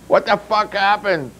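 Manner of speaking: a strained high cry; mild mock scream. Red in the brawl, half-screamed alarm. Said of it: "screamed Red".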